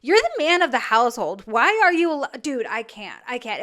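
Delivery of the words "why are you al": ordinary delivery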